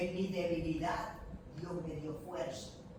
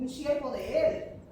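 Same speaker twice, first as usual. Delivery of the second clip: usual speaking voice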